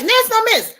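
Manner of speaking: high, loud callout to a friend; excited call-out. Real, not acted. Vlogger calls out Ness No Mess, high excited.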